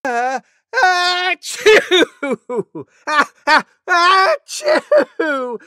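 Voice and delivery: very high, loud squealing sneezes; huge disgusting sneeze. Acted. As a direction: build up and explode the sneeze. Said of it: "huge, disgusting sneeze like this".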